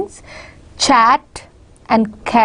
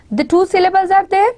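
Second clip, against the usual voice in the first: ordinary talk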